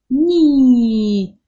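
ني loud, with the ee stretched; ني drawn out loudly for the class; long ee demo. Shown as a demonstration. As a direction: hold out the ee of ني. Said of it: "بص أنا بمد وبطول إزاي فيها؟".

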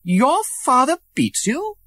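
high shocked question; a prince shocked by the beatings; shocked cry. A narrator's reading. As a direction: gasp at the cruelty. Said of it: "cried the prince".

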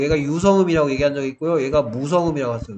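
ordinary talk

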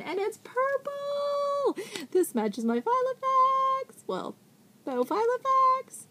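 high, slow delighted squeal; excited squeal. Real, not acted. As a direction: gush over the purple pen. Thrilled unboxing a gift.

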